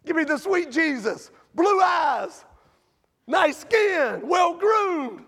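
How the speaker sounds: hammed-up mock shout; mocking list. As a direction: sneer at the pretty picture. Mocking the sweet Jesus picture.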